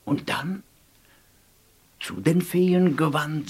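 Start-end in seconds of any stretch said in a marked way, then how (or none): none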